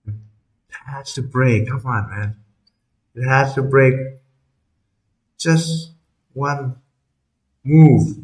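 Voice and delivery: hushed, breath held tight; tense whisper with held breath; holding breath. Real, not acted. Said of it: "I'm holding my breath right now".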